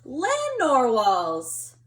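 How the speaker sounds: slow, loud, drawn-out wonder; excited discovery. Acted. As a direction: savour the big reveal. Kelp finds the unicorns.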